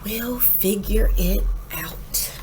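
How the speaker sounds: slow gentle whisper. A narrator's reading. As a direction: whisper it as reassurance to each other. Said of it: "They smiled and they whispered".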